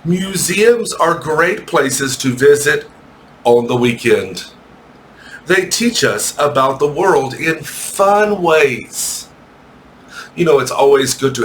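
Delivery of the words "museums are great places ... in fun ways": slow, careful read-aloud for the class. Shown as a demonstration. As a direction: read each phrase slowly and clearly for beginners. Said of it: "I'll read it slowly".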